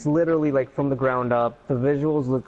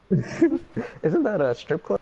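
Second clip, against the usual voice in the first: laughing; 7 semitones higher, much slower than usual. Real, not acted. Laughing joke.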